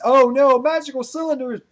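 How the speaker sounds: high, loud cry of dismay; mock dismay. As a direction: yelp as the trap springs on you. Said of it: "You pretty much can go like".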